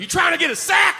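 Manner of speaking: high shouted dealer voice; trailer dealer. Retold or imitated. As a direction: holler an offer like a trailer weed dealer. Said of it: "And this dude's like".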